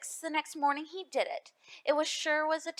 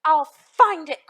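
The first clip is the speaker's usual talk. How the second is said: loud, slow determined cry; cried out; 2 semitones higher, 10 dB louder, slower than usual. A narrator's reading. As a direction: cry it out with resolve. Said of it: "cried Horden".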